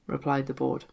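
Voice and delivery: plain reading voice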